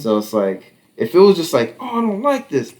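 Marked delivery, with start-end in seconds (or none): 1.8-2.7 put-on high whiny child's complaint; whiny kid. Son whining about the bus, high. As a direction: mimic a child whining, pitched up.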